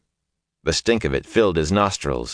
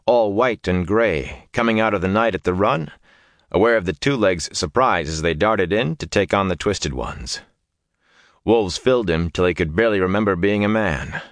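usual speaking voice

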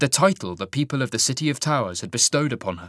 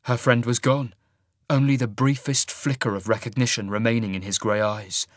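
usual speaking voice

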